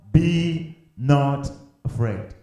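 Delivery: "Be not afraid" word by word, slowly; "Be... not... afraid", slow and weighty; emphatic, deliberate. Shown as a demonstration. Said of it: "asking you to emphasize on that word".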